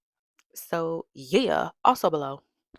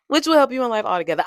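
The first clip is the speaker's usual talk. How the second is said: louder retake; 7 semitones higher, 9 dB louder, much faster than usual. Shown as a demonstration.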